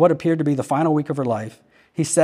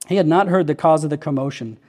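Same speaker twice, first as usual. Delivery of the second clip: plain reading voice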